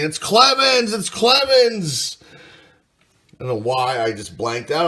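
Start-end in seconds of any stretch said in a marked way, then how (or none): 0.0-2.2 much higher and louder, mock fan yelling the name; imitated fan screaming. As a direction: yell it like an overexcited fan in the stands. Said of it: "some of you guys are screaming".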